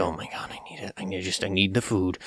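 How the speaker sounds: impatient grumble under the breath; very soft, exasperated muttering; pissed-off customer. Acted. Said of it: "they're just pissed".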